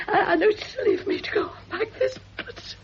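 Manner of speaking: crying, slow and broken; tearful pleading. Acted. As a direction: beg her to stop through tears. Frightened woman pleads, crying.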